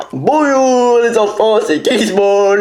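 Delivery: put-on high, bright kids' show clown voice; silly clown. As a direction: greet the kids like a clown. Clown intro voice, named Gizmo.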